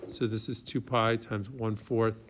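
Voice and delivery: usual speaking voice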